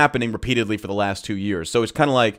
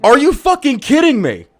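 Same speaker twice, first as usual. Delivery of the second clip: loud, high outraged disbelief; shouted exasperated question; incredulous shout; 12 semitones higher, 8 dB louder than usual. Real, not acted. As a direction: yell it at the screen. Reacting live to press conference.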